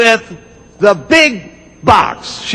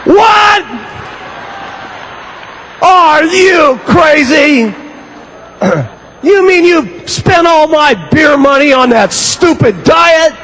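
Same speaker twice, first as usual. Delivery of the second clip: hammed-up shouting husband, drawn out; furious husband; 7 semitones higher than usual. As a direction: yell at the wife over beer money. Husband explodes over the money.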